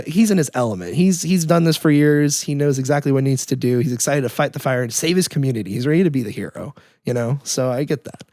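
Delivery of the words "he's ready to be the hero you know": usual speaking voice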